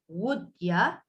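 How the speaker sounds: "would ya" with a weak "ya"; reduced. Shown as a demonstration. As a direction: glide "would" straight into "ya".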